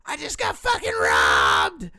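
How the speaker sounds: loud, slow yell of a robbed man; frantic robbery victim yelling; panicked shout. Retold or imitated. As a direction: bellow it like you were just robbed. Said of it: "he's, like, screaming".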